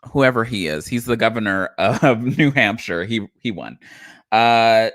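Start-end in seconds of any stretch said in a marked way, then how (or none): none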